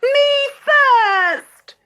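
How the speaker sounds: high, loud wail. A narrator's reading. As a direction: wail like a spoiled sister. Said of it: "wailed Gertrude".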